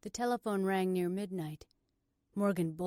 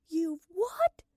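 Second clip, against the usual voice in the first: high, loud disbelief; shocked; 10 semitones higher, 8 dB louder than usual. Acted. Morgan hearing of her sister's arrest, shocked. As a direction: jump on the word "what".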